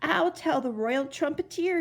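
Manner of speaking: mild shout. A narrator's reading. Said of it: "he shouted".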